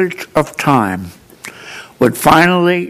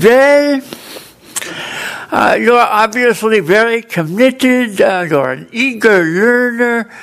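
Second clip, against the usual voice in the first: put-on higher, polite German-accented tutor; German tutor breaks the news to her student, German accent; 8 semitones higher than usual. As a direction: let him down gently in a German accent. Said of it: "a German tutor".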